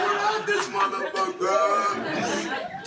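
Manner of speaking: high, loud, slow laughing yell; hyped shout. Retold or imitated. Son standing up to the parents, laughing high shout. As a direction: yell it like a battle cry. Imagined triumphant exit.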